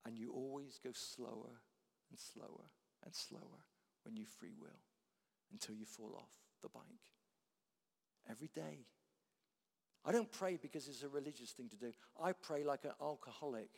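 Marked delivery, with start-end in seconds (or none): none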